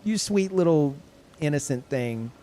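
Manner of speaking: doting on a child, hammed up; talking to a child. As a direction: gush over an innocent little one.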